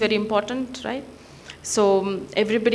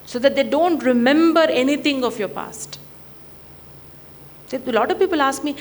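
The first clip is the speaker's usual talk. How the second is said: usual speaking voice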